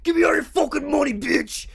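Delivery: high, fast yelled robber demand; robber shout. Retold or imitated. Robber yells at the store clerk, a mock high shout. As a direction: bark it like a robber with a gun.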